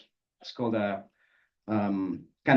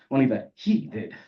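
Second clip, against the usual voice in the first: ordinary delivery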